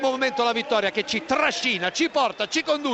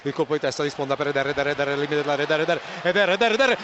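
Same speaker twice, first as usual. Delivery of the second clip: live play-by-play; 8 semitones lower, faster than usual. Real, not acted.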